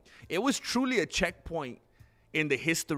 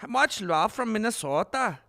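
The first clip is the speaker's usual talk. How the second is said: put-on Minnesota accent; 7 semitones higher, 6 dB louder than usual. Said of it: "I love that accent".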